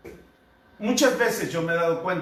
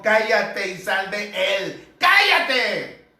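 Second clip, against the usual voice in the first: stern rebuke; 3 dB louder, slower than usual. Acted. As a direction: rebuke the demon, ending in a shout. Jesus rebukes the spirit. (reprensión firme que termina en grito)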